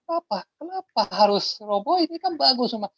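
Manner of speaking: high, loud, stunned disbelief; shocked. Retold or imitated. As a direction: seller shocked the buyer will tear it down. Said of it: "bapak itu hampir pingsan".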